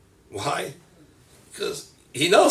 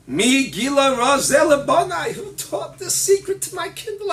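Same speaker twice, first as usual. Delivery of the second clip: higher and louder, amazed exclamation; marveling God; 10 semitones higher, 8 dB louder than usual. Acted. Hashem amazed at his children, high excited voice. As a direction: marvel at the secret revealed.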